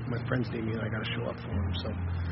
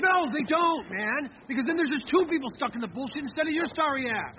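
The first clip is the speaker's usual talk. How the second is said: angry; 13 semitones higher, 5 dB louder than usual. Acted. Heated argument with friend.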